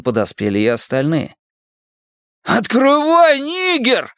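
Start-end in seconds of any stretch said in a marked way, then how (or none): 2.4-4.1 roaring. Acted. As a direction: roar at the boy behind the gate. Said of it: "проревел Генри".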